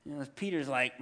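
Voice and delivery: ordinary talk, nothing marked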